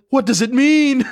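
loud, high, hammed-up plea; mock bewilderment. Dreamer begging friends to explain his dream, hammed-up bewildered cry. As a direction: cry it out in comic bafflement.

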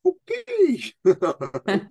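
high, startled okay; taken aback as she rushes the stage; surprised okay. Retold or imitated. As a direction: caught off guard, go up on it. Startled reaction to volunteer.

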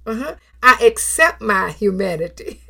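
ordinary talk, nothing marked

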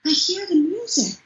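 high-pitched imitation of a thrilled small child; little boy's voice. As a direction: tell mom like an awestruck little kid. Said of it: "to hear that little voice".

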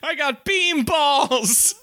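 high, slow, through laughter; laughing. Pretend. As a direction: squeak it out laughing. Joins the joke laughing.

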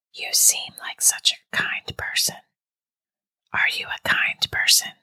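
coaxing, voiceless hush; ghostly caller, all breath; eerie whisper. A narrator's reading. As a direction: whisper to draw the listener in. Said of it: "the whisper asked".

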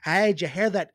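put-on loud, unsure Australian accent; half-found Australian accent; attempted Australian accent. Host searches for the accent, attempted Australian. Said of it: "how's an Australian sound?".

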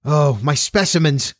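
slow, drawn-out cry of dismay; mildly panicked exclamation; alarmed cry. A narrator's reading. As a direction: cry out in sudden worry. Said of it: "cried the doctor".

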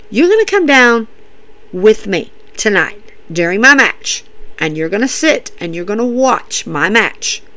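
low, soft, measured lecture; stern instruction. Retold or imitated. Randy tells Matt to watch and learn, quiet stern imitation. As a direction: lay down the terms quietly and firmly. Acting Randy laying down terms.

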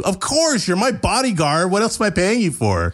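high, entitled YouTuber voice; spoiled star voice, bossy and high. Acted. Sam voiced, bossy, pitched up.

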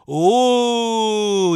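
loud, high, long "oooooh"; drawn-out crowd-style "ooh"; scandalized "ooh". Real, not acted. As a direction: whoop at a savage burn. Mock reaction to an insult.

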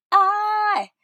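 imitating a big stage entrance, belted and high. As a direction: announce yourself like a stage diva. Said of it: "I borrowed that from [name]".

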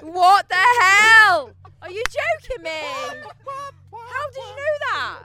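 high, loud disbelieving shout; shocked. Real, not acted. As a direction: yell out in disbelief. Shocked at correct answer.

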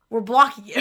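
ordinary talk, nothing marked

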